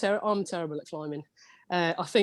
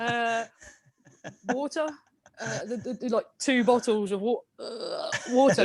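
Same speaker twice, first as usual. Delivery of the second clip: hesitant, higher-pitched attempts at the word; re-enacted halting, unsure order; halting retry; 5 semitones higher than usual. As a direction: act out fumbling to be understood. Retells struggling to be understood.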